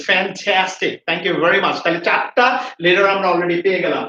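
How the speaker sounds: ordinary talk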